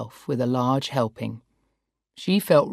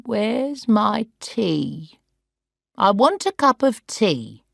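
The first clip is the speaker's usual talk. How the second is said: put-on demanding old woman; 6 semitones higher, slower than usual. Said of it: "demanded Sarah".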